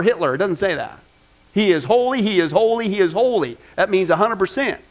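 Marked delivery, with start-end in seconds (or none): 1.5-3.6 louder, each holy leaned on; emphatic repetition. Shown as a demonstration. Preacher insisting God is holy, emphatic. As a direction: drive it home, holy holy holy. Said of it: "that is for emphasis".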